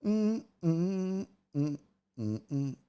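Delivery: normal talking voice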